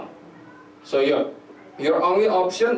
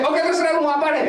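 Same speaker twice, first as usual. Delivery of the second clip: fast, much higher dismissive parent voice; a parent brushing off a sleepless child, high and fast; 13 semitones higher, 4 dB louder, much faster than usual. Retold or imitated.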